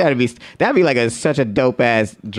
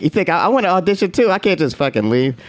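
bitter retort; 8 semitones higher than usual. Retold or imitated. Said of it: "because I'm like".

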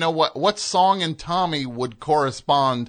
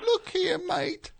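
much higher, tiny Cockney manager; high squeaky Cockney; 6 dB quieter than usual. Shown as a demonstration. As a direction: pitch it way up like a very small man. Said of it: "his voice was way higher".

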